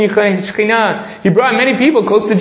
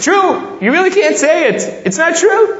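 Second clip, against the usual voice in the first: excited objection; 9 semitones higher than usual. Acted. Voicing the objection with amazement.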